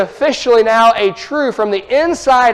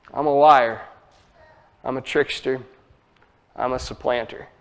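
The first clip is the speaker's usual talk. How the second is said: low, much softer confessing voice; a lowered, hushed admission; humble confession; 6 semitones lower, 6 dB quieter than usual. Retold or imitated. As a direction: admit it quietly, ashamed. Jacob's quiet confession.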